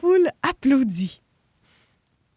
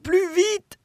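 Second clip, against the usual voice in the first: encouraging; 8 semitones higher, 4 dB louder than usual. Acted. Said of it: "l'encouragea Noyau".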